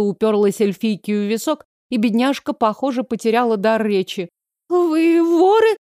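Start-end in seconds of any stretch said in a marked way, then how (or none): 4.7-5.8 very high, slow, drawn-out voice; frightened question. A narrator's reading. Elf girl with weapon at her temple, frightened high question. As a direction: squeak it out slowly in fear. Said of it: "От страха её лицо стало белее мела".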